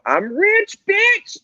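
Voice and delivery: loud, high-pitched boastful shout; over-the-top high holler; gleeful mock yell. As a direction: yell it like a bragging new millionaire. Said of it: "I will yell".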